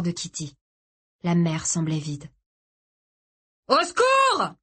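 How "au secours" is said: high, drawn-out shout; desperate cry for help. A narrator's reading. Boy shouting for rescue at night, desperate yell. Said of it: "cria-t-il dans la nuit".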